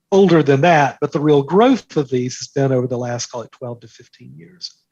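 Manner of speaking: ordinary talk, nothing marked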